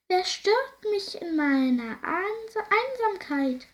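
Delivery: high, slow, eerie ghost voice startled from solitude; surprised ghost. Acted. Ghost startled by the princess intruding, high and eerie. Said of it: "wisperte es überrascht".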